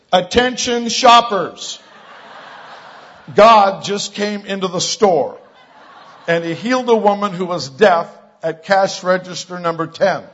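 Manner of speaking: loud, high, drawn-out store announcement; intercom announcement. Retold or imitated. Young man addressing shoppers over the intercom, announcement voice. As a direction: project slowly to a whole store, imitating an intercom. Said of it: "gets on the intercom and he says".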